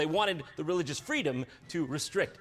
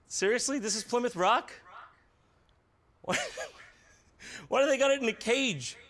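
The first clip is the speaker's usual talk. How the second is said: ordinary talk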